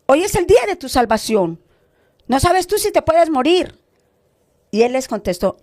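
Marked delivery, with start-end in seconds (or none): 0.0-3.8 raised-pitch urgent evangelist's plea; acted earnest entreaty, pitched high; insistent pleading. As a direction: implore them with rising urgency, voicing a retold appeal. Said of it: "insistía y le decía".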